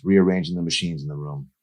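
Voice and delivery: ordinary delivery, nothing marked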